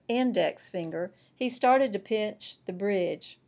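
ordinary delivery, nothing marked

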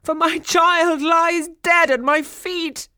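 slow, high, loud lament; weeping. A narrator's reading. King mourns his child lying dead, wailing. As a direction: wail in theatrical despair. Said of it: "wept the king".

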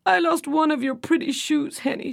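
high, mildly tearful mock sob; a light, storybook sob over the lost shoe; sobbing. A narrator's reading. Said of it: "she sobbed".

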